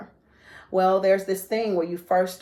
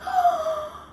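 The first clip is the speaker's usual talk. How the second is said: sharp high gasp; imitating a stranger gasping at the missing finger; shocked gasp. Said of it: "somebody else goes".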